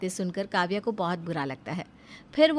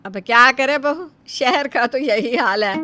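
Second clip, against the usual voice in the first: loud, higher, slow mother-in-law shrugging it off; mother-in-law, resigned; 4 semitones higher, 10 dB louder than usual. Acted. Mother-in-law answering her daughter-in-law about prices, loud and slow. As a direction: boom it out like a weary saas.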